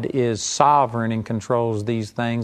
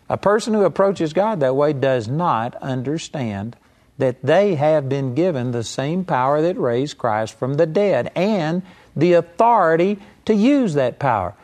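usual speaking voice

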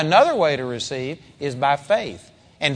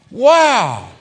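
high, loud astonished wow; shocked wow; 5 semitones higher, 6 dB louder than usual. Shown as a demonstration. Peter staring at the tree, astonished. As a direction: let the wow burst out. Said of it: "He was shocked".

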